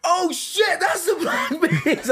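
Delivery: very high, thrilled fan yell; retold fan screaming. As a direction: squeal it like a starstruck fan. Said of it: "I'm screaming, I'm like".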